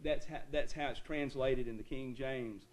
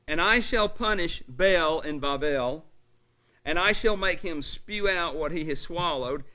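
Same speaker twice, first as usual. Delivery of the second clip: scripture reading; 7 dB louder than usual. Acted. Reading Jeremiah aloud from pulpit.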